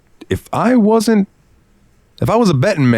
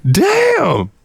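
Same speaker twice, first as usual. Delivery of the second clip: shocked exclamation. Real, not acted. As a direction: yelp like you've just been roasted. Stung by her comeback.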